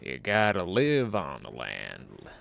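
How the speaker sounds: put-on cowboy accent, slow and gruff at the end; a lone cowboy's slow, loud cowboy accent fading to a whispered creed. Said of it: "I'm getting tired of the cowboy accent".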